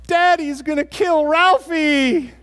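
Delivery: put-on high, loud, slow wailing child voice; little brother sobbing that dad will hurt his brother; crying little boy. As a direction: wail it like a scared little kid under the cabinet. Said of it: "And he goes".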